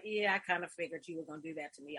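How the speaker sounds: low, soft and quick; mock resignation, tossed off under the voice; resigned reply, imitated. Student giving in to mentor's program request, imitated low resignation.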